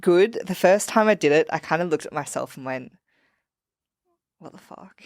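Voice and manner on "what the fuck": whispered in disbelief; stunned whisper. Retold or imitated. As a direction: breathe it out, baffled at yourself. Shocked at own mirror reflection.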